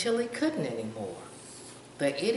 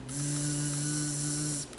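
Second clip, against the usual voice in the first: a long steady zzz buzz; buzzing bee noise; 2 semitones lower, 4 dB quieter than usual. Shown as a demonstration. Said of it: "they sound like".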